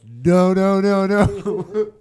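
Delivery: high, loud mock panic; acted-out rising panic with a laugh in it; panicked yelling. Kid realising they are choking, mock panicked cries.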